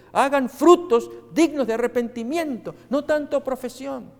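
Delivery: preaching proclamation. Acted. Preacher proclaiming the Baptist's call to the congregation. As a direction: thunder the Baptist's command.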